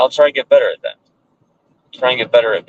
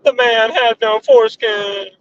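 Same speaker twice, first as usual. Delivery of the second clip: silly high voice. Pretend. Joke line in put-on voice.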